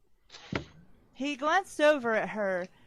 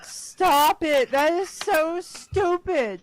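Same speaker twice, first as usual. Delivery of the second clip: loud, high exasperated outburst; mock freak-out; 6 semitones higher, 6 dB louder, slower than usual. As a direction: imitate someone losing it, drawing out the words. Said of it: "he just freaked out because he's like".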